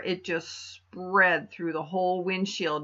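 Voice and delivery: usual speaking voice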